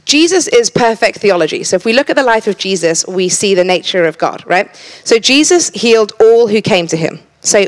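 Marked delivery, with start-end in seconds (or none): none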